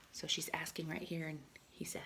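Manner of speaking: soft whisper; quiet whisper pointing at page; whispered aside. Real, not acted.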